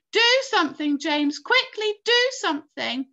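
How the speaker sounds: urgent high, loud plea; crying out. A narrator's reading. Earthworm begging the boy as they fall, crying out. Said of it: "cried the earthworm".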